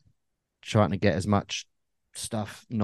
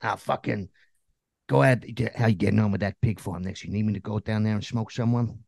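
New Jersey mobster; 5 dB louder than usual. Acted. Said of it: "my, um, New Jersey mobster voice".

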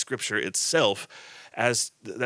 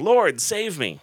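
mild plea; 7 semitones higher, 3 dB louder, slower than usual. Acted. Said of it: "he cried out, saying".

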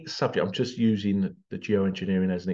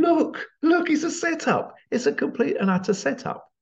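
urgent shout. Retold or imitated. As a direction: cry out that it's all a setup. People on floor crying setup.

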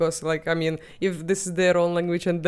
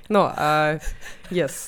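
normal talking voice

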